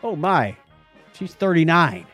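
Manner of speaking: normal talking voice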